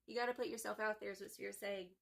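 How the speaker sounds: normal talking voice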